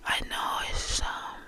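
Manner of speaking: dreamy intimate whisper. Real, not acted. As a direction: murmur it like a meditation. Mystical whispered musings.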